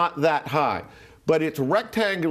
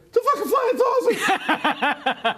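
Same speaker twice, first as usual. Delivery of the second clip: shocked shout; slower than usual. Retold or imitated. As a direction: blurt it out in total shock, half laughing. Friend spots the UFO, shouts.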